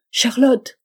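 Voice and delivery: loud, high desperate call; calling again. A narrator's reading. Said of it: "cria-t-il". (appeler Charlotte encore, désespéré)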